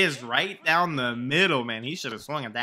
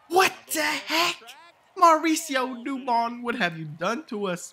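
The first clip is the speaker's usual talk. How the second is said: shocked shout; 9 semitones higher than usual. Real, not acted. Gamer stunned by a sudden home run, shocked shout. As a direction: blurt it out in disbelief.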